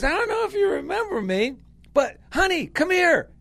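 high and fast, imitating an excited young man; eager calling over. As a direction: rush it out, then call the wife over. Young man calls wife over.

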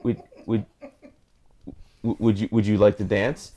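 soft, low, halting request; nervous stammer. Acted. Shy loser asking a girl to dance, stammering nervously. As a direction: stumble shyly over the invitation.

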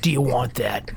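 low put-on waitress voice; waitress impression. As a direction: ask it like the waitress, a little low. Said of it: "Does she kinda talk like this?".

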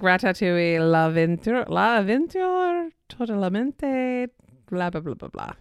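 stumbling mock accent; fake French-Spanish accent. Shown as a demonstration. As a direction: mangle the foreign title with gusto. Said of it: "I won't try to pronounce the name".

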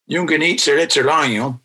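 "Yung ganit sirlet sirlayo" in Mohawk, weight on ganit; Mohawk, stress on "ganit"; Mohawk word. Shown as a demonstration. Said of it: "how many syllables that is".

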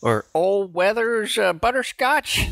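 put-on old prospector. Old man voice continued.

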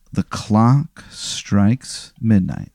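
very slow, hushed storyteller; suspenseful, spaced-out scene setting; ominous narration. Pretend. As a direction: draw out each word as midnight arrives. Game master sets midnight scene.

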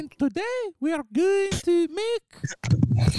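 high, slow, airy housewife voice; breathy cooking-show host impression; airy Scottish cooking lady. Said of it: "she has a very airy voice".

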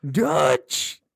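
loud shrill old-man impression; John voice. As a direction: call out like a shrill old cowboy. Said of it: "do a John voice".

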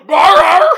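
loud, high imitated dog bark; shouted woof from the hero's dog. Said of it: "I done heard a dog go".

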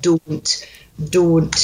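"Don't" with a pure long O; Scottish "Don't", modelled for a learner; Scottish O sound. Shown as a demonstration. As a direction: say "Don't" with one unglided O, each evenly. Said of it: "we need to get that O sound".

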